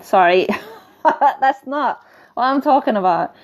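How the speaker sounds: real giggle after swearing; laughing apology. Real, not acted. Apologizing to listeners for saying arseholes, laughing.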